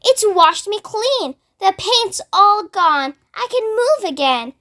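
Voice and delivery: high, slow, delighted; bursting with relief; joyful excitement. A narrator's reading. Centipede celebrates his freedom, excited high voice. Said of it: "shouted the centipede excitedly".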